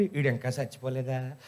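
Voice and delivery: low, soft disbelieving mutter; muttered aside. Retold or imitated. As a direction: mutter it under your breath in disbelief. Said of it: "ఆ voice ఇప్పటికే వింటుంటే".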